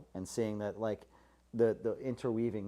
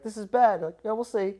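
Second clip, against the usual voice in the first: much higher and louder, hammed-up distress; mock dismay; 10 semitones higher, 8 dB louder than usual. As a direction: overplay the bad news, then shrug. Mock dismay then shrug.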